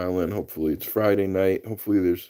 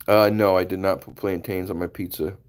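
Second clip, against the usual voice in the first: ordinary talk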